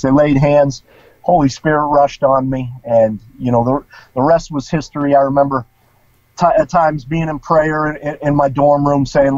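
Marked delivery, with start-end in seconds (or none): none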